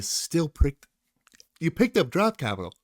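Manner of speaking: talking through a yawn. Real, not acted. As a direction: yawn mid-sentence. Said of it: "Sorry, I'm yawning".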